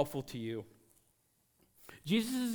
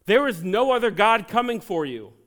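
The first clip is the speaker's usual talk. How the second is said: loud, ringing pulpit proclamation; preacher hitting the climax; full-force preaching; 6 semitones higher, 10 dB louder than usual. Real, not acted. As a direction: declare it to the whole room.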